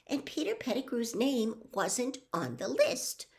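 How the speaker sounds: put-on high-pitched, earnest girl's voice; trembling, would-be calm. A narrator's reading. Hermione explaining the Animagus register to Lupin, high and anxiously calm. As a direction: reason it out insistently, pitched high. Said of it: "Hermione spoke in a trembling, would-be calm".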